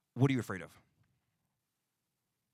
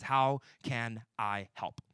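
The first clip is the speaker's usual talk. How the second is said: slow question; much slower than usual. Shown as a demonstration. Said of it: "the big question that leaders ask".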